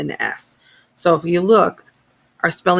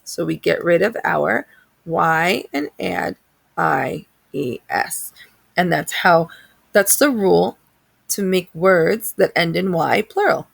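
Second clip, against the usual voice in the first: ordinary delivery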